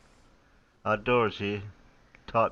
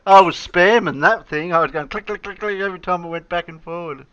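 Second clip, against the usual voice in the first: excited retelling; 8 semitones higher, 9 dB louder than usual. Real, not acted. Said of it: "excited retelling of glitch".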